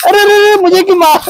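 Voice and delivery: high, playful cry of mock pain; mock hurt protest. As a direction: pretend you're being beaten, whine it up high. Kids hitting the parent playfully. (मज़ाक में ऊँची आवाज़ में दर्द भरी शिकायत)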